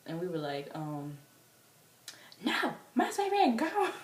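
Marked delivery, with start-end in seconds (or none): 2.4-3.9 high, loud mock wail; hammed-up squealing protest; distraught fan protest. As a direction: wail in denial like a devoted fan. Acted fans protesting the announcement.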